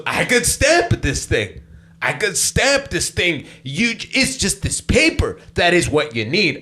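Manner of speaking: slow, high-pitched, emphatic explanation; drawn-out mock-officious insistence; imitated exasperated officer. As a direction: spell it out like a fed-up official.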